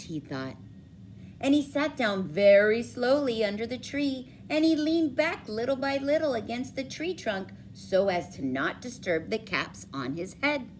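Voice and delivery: ordinary delivery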